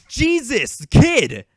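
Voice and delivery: loud, high retold exclamation; exasperated mock yell; shocked outburst. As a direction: burst out, appalled at a kid. Retold shocked reaction to kid.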